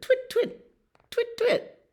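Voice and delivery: very high, chirpy bird twitter; starling twitter. A narrator's reading. Reading the starlings to a child, high twittering.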